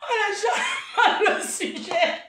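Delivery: lent, plus aigu, entrecoupé de rires; répète la phrase en éclatant de rire; en riant. Real, not acted. Se moque en riant. (rire franc à travers la phrase)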